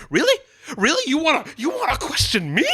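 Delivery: high mock furious challenge; hammed-up bloody Rick rage; Rick's fury. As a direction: rage at them, rising to a peak. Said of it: "He's like".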